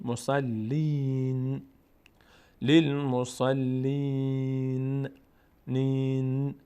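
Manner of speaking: مسلین slowly chanted with a long held ee; sustained recitation of مسلین, final n sounded. Shown as a demonstration. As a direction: stretch the ی in مسلین on a level tone. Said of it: "یا، صدای ای".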